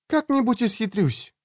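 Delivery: put-on high, soft girl's voice; lightly voiced girl, high and quiet; promising girl. A narrator's reading. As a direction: promise it in a lifted, softened voice. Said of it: "пообещала Кэтрин".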